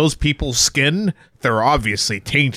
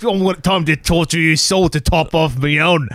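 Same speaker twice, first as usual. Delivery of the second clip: mock Australian. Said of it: "in an Australian accent".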